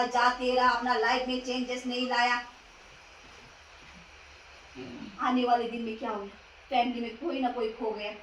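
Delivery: low, hushed sermon reproach; grave preaching. Acted. Preacher reproaching believers who never changed, grave and quiet. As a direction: drop right down, soft and sober.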